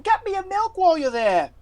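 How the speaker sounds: put-on high, loud child calling out; a boy shouting after you not to leave; boy calling back. Boy calls player back.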